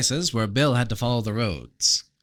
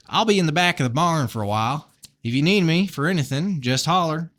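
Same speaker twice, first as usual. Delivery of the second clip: put-on higher Southern-accented farmer; 5 semitones higher than usual. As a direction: call it out with a friendly Southern twang. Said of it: "really bad Southern accent".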